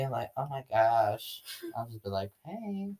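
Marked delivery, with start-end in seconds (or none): 0.4-1.4 put-on slow, softer Valley girl exasperation; Valley girl accent. Girl showing how she answers annoyed cousins, Valley girl accent. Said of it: "get annoyed with me". 2.4-2.9 put-on high, soft Valley girl hey; Valley girl accent. Girl showing friend her greeting, soft Valley girl accent. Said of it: "Sometimes I'll do that accent".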